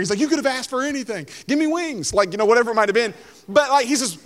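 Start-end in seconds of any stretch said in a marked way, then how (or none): 0.2-2.1 higher-pitched, mildly indignant complaint; hammed-up annoyance at a wasted chance; mock exasperation. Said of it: "like, he gets angry".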